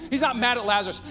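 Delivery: normal talking voice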